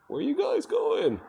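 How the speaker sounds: high, slow squeaky pet voice; voicing the dog. Vlogger voicing a dog in a pet store, squeaky high. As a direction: give the dog a silly little voice.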